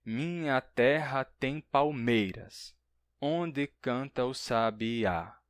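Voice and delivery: slow syllable-by-syllable verse reading; measured verse recital. Shown as a demonstration. Teacher giving a poetry example, measured verse recital. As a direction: recite the verse slowly, marking each syllable. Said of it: "conta as sílabas até a última".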